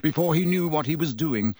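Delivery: ordinary delivery, nothing marked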